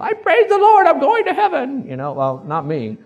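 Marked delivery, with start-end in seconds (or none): 0.0-1.9 loud, very high-pitched pious squeal; mock super-spiritual believer. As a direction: gush it like an over-spiritual believer, hammed-up. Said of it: "some of you are so spiritual".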